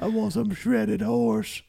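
high, slow granny voice; grandma voice. Acted. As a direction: drawl it like an old grandma. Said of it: "grandma voice".

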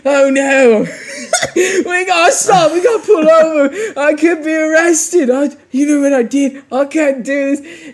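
high, loud panicked babble through laughter; freaking out. Retold or imitated. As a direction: panic like you just hit a car and could be arrested. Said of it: "he's, like, freaking out".

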